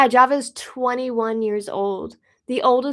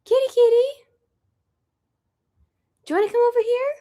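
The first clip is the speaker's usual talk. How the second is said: much higher, sing-song pet voice; calling the kitty; 12 semitones higher, 3 dB louder, much faster than usual. Real, not acted. Calling her cat over in a high pet voice. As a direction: coax the cat to come to you.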